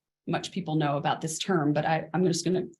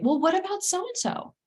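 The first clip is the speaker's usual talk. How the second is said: higher, bright-eyed fixing parent; eager parental reassurance; 7 semitones higher than usual. Retold or imitated. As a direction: brush it off by cheerfully listing friends. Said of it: "be tempted to say".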